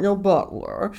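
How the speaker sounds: lightly voiced British butler; British butler accent. Shown as a demonstration. Showing off British accents to the co-host, British butler accent. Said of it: "whole library of British accents".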